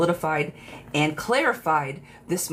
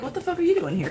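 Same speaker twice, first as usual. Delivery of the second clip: higher, startled 'what are you doing here'; mock shocked outburst; 11 semitones higher, faster than usual. The guy in the reading, caught off guard seeing her, mock shocked. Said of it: "kind of way".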